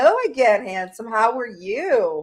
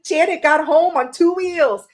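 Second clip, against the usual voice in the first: laughing tease; 7 semitones higher, 4 dB louder than usual. Real, not acted. Teasing friend, laughing.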